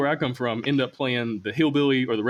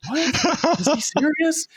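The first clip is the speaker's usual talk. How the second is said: high squeaky startled "What? Is he serious?"; mock disbelief; 14 semitones higher, 3 dB louder, much slower than usual. Strangers gawking at a wheelchair user heading for stairs, mock shock. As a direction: play the baffled stranger, pitched way up.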